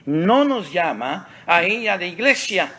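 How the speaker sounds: high, loud pulpit proclamation; emphatic preaching. Real, not acted. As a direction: drive it home loudly from the pulpit. Preacher drives home his closing point.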